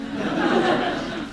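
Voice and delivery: a quick quiet unvoiced laugh; soft chuckle. Real, not acted. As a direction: chuckle at your own joke. Laughs at Mother Teresa joke.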